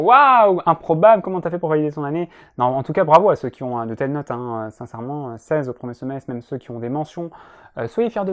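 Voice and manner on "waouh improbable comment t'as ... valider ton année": mock surprise. Student feigning shock at passing first year, mock surprise. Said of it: "font genre d'air surpris".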